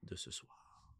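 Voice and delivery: breathy stage whisper. Acted. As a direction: whisper it like a secret. Teasing the episode start.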